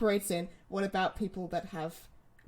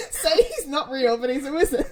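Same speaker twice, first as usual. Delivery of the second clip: laughing; 5 semitones higher, 8 dB louder than usual. Real, not acted. Laughing while arguing a point.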